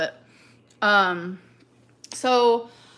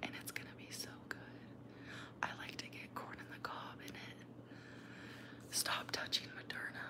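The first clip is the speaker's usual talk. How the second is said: soft close whisper; breathy whisper, barely voiced; 23 dB quieter, faster than usual. Real, not acted. Whispered eating chat.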